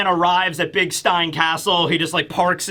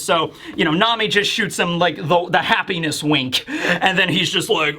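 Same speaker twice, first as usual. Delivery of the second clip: normal talking voice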